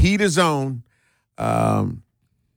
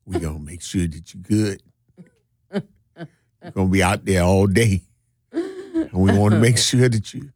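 teasing, deep-pitched master act; mock massa voice; 7 semitones lower than usual. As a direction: imitate massa with a low, unhurried assurance. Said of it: "you saying it like massa".

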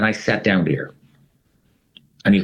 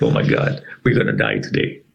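scared whisper; 14 semitones lower than usual. Retold or imitated. Cornered by security guards.

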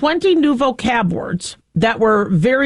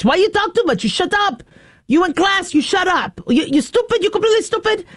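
higher-pitched mock scolding mom, pushy broken English; 7 semitones higher than usual. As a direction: play the mom scolding her chatty daughter, pitched up. Mom scolding daughter over report card.

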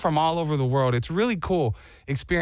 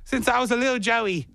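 higher mock Australian; fake Australian accent; 5 semitones higher than usual. Fake Sydneysider describes childhood, hammed-up Australian accent. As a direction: lay on the fake Aussie. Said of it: "you're slipping on your accent".

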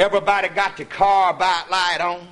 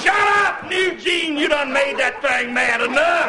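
retold yell; 6 semitones higher than usual. Hunters yelling at Eugene.

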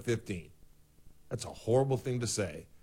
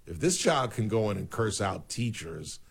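ordinary talk

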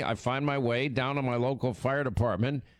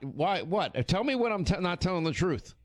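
ordinary talk

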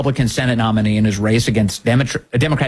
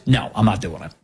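mock curt refusal; 6 semitones lower than usual. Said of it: "Cornyn says".

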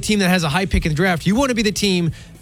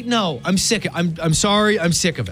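usual speaking voice

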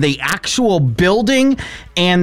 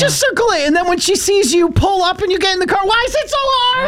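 high, strained exasperation; high strained exasperated complaint; exasperated rant. Real, not acted. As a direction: plead in disbelief at the rule-breaker. Rising exasperation at Laura's mom.

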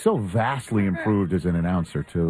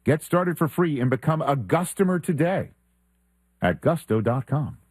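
high, upbeat announcer delivery; sponsor read; 7 semitones higher than usual. Acted. Radio host presenting the show's sponsor, announcer ad read. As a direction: sell it like a radio sponsor spot.

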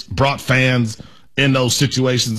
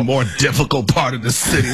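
mocking; 4 semitones lower than usual. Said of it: "such a British thing".

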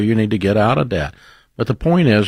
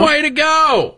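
a much higher cheer that jumps out of his speaking voice; excited cheer; slower than usual. Real, not acted. Host congratulating caller on paying off debt, excited cheer. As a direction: cheer her on, up high and bright with delight. Caller finished baby step three.

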